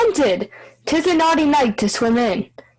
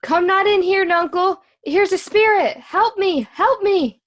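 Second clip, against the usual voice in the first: frightened cry for help. Acted. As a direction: cry out in fright, pitched well up. Fool flees spirit in hovel, calls help.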